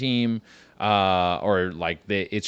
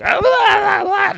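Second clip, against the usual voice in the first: very high, loud hammed-up panicked wailing; panicked woman; 7 dB louder, slower than usual. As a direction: squeal it like a terrified woman babbling. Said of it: "and she's like".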